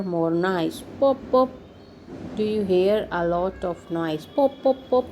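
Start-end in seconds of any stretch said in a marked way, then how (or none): 1.0-1.5 popping sound. Acted. Read-aloud popping sound effects. 4.3-5.0 popping sound. Acted. Read-aloud popping sound effects.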